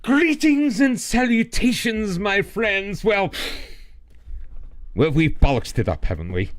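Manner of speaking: a badly faked, very proper English accent; hoity-toity British accent. Streamer voicing British viewer's greeting in hammed-up posh accent. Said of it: "it's a terrible accent".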